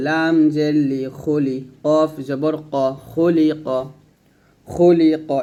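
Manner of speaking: ordinary talk, nothing marked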